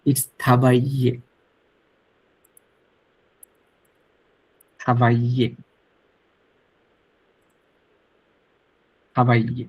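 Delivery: slow model of থাবাইয়ে, l dropped; silent double l. Shown as a demonstration. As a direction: say থাবাইয়ে as "ta-bai-ye", no l sound. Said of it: "not থাবাইলে".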